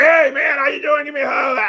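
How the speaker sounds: high, loud greeting; mock cheerful greeting; cheery sick brother. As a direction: greet him like a cheerful brother who's hiding a cold. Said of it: "He's like".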